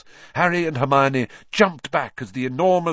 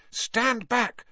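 high-pitched urgent call; 13 semitones higher than usual. A narrator's reading. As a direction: call a warning without much volume. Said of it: "Hermione called to him".

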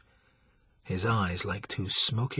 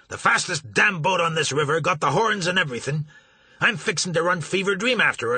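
louder and higher, sharp and heated; angry snap; 6 semitones higher, 4 dB louder than usual. Acted. Marsh scolding York for his rude welcome, angry. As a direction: tell him off, stung and indignant. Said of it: "he snapped".

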